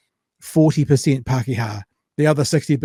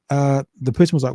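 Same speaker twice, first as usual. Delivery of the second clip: ordinary talk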